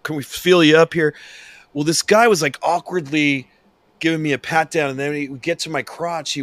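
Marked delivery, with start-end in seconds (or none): 0.0-1.1 mock TSA agent. Imitating TSA pat-down request.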